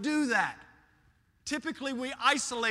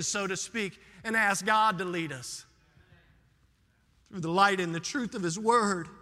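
usual speaking voice

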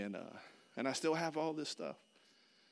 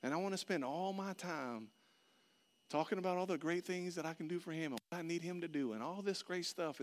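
ordinary talk

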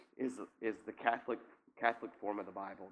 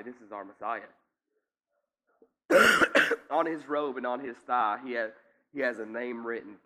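ordinary talk

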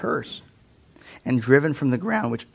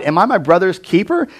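copping an attitude; 6 semitones higher, 7 dB louder than usual. Retold or imitated. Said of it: "cops an attitude with God".

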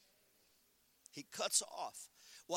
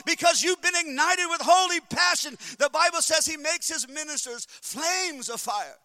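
loud, high, building preacher's shout; full-force preaching; 8 semitones higher, 12 dB louder than usual. Acted. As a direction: lift the church up on holy passion. Preaching climax.

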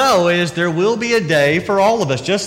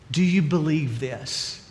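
quietly asking; 2 semitones lower, 6 dB quieter, slower than usual. Acted. Jesus asks Martha directly.

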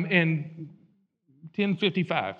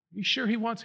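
ordinary delivery

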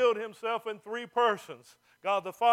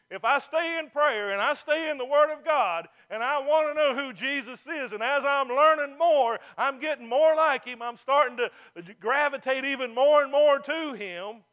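louder building sermon; preaching; 3 semitones higher, 5 dB louder than usual. Acted. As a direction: drive the point home from the pulpit. Preaching at rising force.

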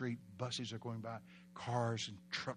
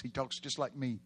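ordinary delivery

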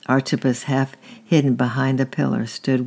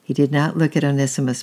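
ordinary delivery

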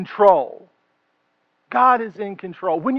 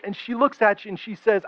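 ordinary talk

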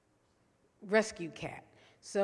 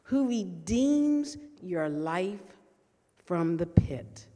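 slow, emphatic psalm reading with long pauses; scripture reading; 3 semitones lower, 4 dB louder, much slower than usual. Acted. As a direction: read the psalm slowly, leaning on each phrase.

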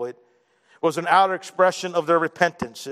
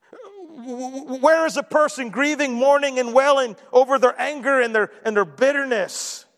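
raised, rising pulpit cry; impassioned preacher, pitched up and pushing; preaching at full force; 7 semitones higher than usual. Acted. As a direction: pile on the questions, voice climbing high.

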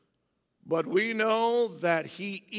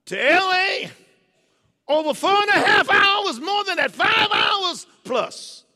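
full-force preaching; 8 semitones higher, 9 dB louder than usual. Acted. Preacher building the congregation to a loud climax. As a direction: preach it out to the rafters.

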